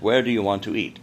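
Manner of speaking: ordinary talk, nothing marked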